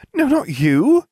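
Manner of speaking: high, loud, irritated retort; sharp exasperated girl's voice; snapped. A narrator's reading. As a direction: cut someone off crossly. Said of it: "she snapped".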